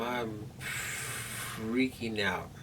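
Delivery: soft, slow-motion retelling of inner panic; drawn-out freaking out. Retold or imitated. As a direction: stretch FREAKING out very slowly. Dramatizing panic before the surgery.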